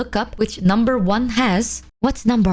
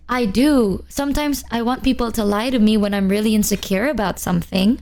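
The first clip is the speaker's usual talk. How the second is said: normal talking voice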